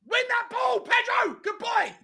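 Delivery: high, loud shouted encouragement; cheering shout. Real, not acted. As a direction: urge your player on loudly. Cheering on a player.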